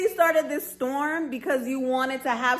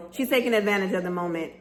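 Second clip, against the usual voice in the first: ordinary delivery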